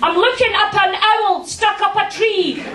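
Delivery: high, earnest reporter singsong; local news reporter. Retold or imitated. Local TV reporter on a silly owl story, high and earnest. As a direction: chirp it like a keen rookie newsreader. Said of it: "You know that woman".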